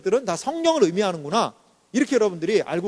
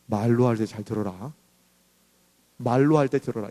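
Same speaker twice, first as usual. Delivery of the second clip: mock parental threat; 10 semitones lower than usual. Parent threatening a misbehaving child, lightly voiced low warning. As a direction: warn the child darkly, in mock. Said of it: "위협할 때 이렇게 얘기하죠".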